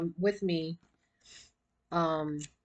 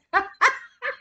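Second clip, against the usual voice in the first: high bright laugh bursting out; burst of laughter; 12 semitones higher, 5 dB louder than usual. Real, not acted. Laughing at own joke.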